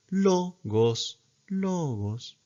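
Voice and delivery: "Logos" high, then dropping down; acute pitch accent. Shown as a demonstration. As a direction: sing "Logos" a fifth up, then down.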